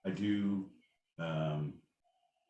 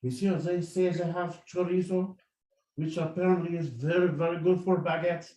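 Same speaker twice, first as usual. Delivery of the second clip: mock French officer; 8 semitones higher, 5 dB louder than usual.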